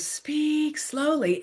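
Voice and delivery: drawn-out, deliberate words; slow. Shown as a demonstration. Tutor reminding tutors of their pace, slow. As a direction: stretch the words to show the pace.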